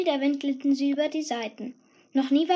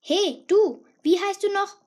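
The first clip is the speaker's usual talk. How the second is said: loud and higher call across the room; higher, louder demanding call; louder, sharper; 6 semitones higher, 5 dB louder than usual. Acted. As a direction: call her out dismissively, loud. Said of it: "Ihre Stimme wurde lauter".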